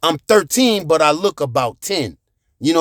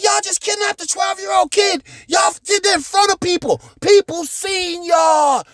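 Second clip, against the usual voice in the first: screaming threats; 12 semitones higher than usual. Retold or imitated. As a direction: scream at the kidnappers that they're going to jail. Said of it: "So I was like".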